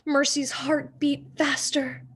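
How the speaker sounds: plain reading voice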